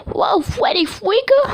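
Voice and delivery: high, slow put-on voice for a friend; imitating a friend. Friend on the phone greeting him, high and slow. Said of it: "He was like".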